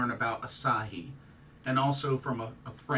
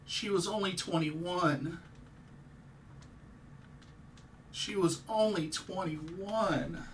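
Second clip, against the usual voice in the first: higher, slow and subdued, grief held in; a hushed, pained repeat; quiet lament; 5 semitones higher, 5 dB quieter, slower than usual. Real, not acted. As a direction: murmur it in disbelief. Grieving a young wrestler's death.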